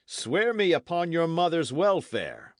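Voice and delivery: loud, high, forceful demand; crying out. A narrator's reading. Knight demanding a binding oath, loud and fierce. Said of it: "cried the knight".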